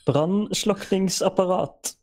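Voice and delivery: Brandslukkingsapparat said slowly, syllable by syllable; patient teacher repeats Brandslukkingsapparat slowly; slow clear pronunciation. Shown as a demonstration. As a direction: spell out Brandslukkingsapparat slowly for a learner. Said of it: "came by me a little fast there".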